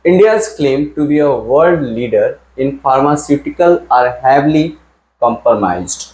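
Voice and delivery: reading the sentence slowly and clearly; teacher reading a test sentence aloud; slow dictation reading. Shown as a demonstration. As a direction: read "India's claim to be a world leader" at half speed. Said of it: "बताओ error कहाँ पर है".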